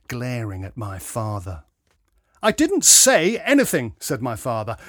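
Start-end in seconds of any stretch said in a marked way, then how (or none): 2.4-3.9 loud, high-pitched protest; indignant retort. A narrator's reading. Father answering the lout, indignant retort. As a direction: protest it firmly and loudly.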